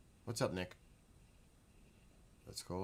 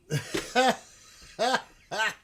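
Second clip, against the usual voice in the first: high bright giggle; laughing; 14 semitones higher, 13 dB louder than usual. Real, not acted. Amused laugh.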